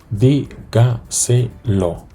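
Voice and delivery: dígaselo drawn out slowly, syllable by syllable; slow teacher's stress demo. Shown as a demonstration. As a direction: stretch dígaselo out, hitting DÍ. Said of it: "el golpe de voz la recibe".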